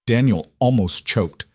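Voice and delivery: ordinary delivery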